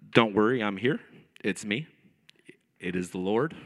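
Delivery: very low, booming announcer-like Lord; megaphone God voice, mocked. Preacher mocks Jesus on a megaphone, deep and booming. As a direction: intone it deep and portentous, as parody. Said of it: "didn't sound like this megaphone was on the boat".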